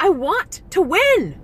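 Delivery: retelling telling the coach, a high petulant whine; whiny demand. Retold or imitated. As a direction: whine that you just want to win. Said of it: "I'm like".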